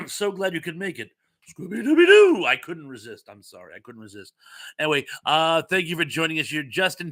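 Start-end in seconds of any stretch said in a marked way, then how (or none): 1.6-2.4 high, loud playful howl; sudden high sing-out, rising big; cartoon catchphrase call. Playful catchphrase call, couldn't resist.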